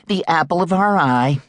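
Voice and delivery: a doting mother speaking of her son; voiced Mrs Clare.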